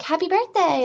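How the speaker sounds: high-pitched imitation of a cheery receptionist; chirpy greeting. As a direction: mimic her gushing, high greeting. Chirpy desk lady greets her.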